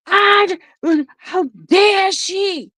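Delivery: high, drawn-out indignant mother; outraged. Retold or imitated. Mother fuming to her child about the lady, outraged.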